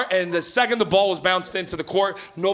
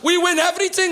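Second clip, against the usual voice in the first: higher proud immigrant dad; Portuguese dad accent; 9 semitones higher than usual. Retold or imitated. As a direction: boast in the dad's accent. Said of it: "He goes".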